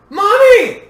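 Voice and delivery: very loud, high-pitched scream; little girl's piercing cry; child's scream. A narrator's reading. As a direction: scream for mother in alarm. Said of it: "Yui screamed".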